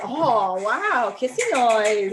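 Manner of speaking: loud, wowed doggy voice; be a thrilled dog hearing its cue; loud, delighted amazement; voicing excited dog. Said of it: "He's getting excited. He's like".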